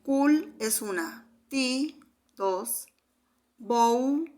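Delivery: slow syllable count, "Cul", "ti", "bou" each drawn out and set apart; counting syllables aloud. Shown as a demonstration. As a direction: stretch "bou" loud as one joined syllable.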